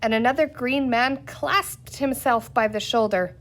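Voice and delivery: usual speaking voice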